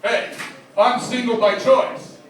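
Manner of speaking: usual speaking voice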